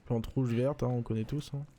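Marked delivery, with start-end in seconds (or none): none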